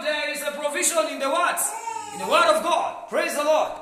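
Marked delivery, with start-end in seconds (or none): none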